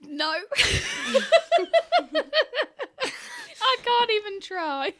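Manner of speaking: high-pitched embarrassed laugh. Real, not acted. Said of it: "Can we hear the accent?".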